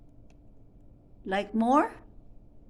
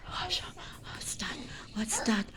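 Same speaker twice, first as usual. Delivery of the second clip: whispering; 11 dB quieter than usual. Real, not acted. Hushing baby, whispering.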